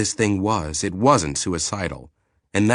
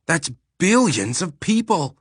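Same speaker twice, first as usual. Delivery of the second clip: shocked outburst; 11 semitones higher than usual. Acted. Eric shocked by the number.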